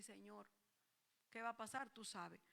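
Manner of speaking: soft, low plea to the Lord; hushed, worried prayer; quiet anxious prayer. Retold or imitated.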